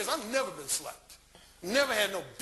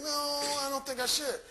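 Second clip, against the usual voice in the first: higher female voice, long drawn "No"; mock-reluctant woman, pitched up; imitated inner refusal; 6 semitones higher than usual. Woman's intellect overruling her desire, higher imitated refusal. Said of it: "my intellect is saying".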